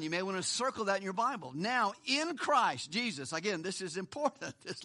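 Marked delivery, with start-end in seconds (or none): 1.5-3.3 "in" drawn out and weighted, phrase slowed; stress on IN. Shown as a demonstration. Preacher pointing out the little word, stress on "in". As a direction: lean slowly on the word "in". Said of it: "notice the little word".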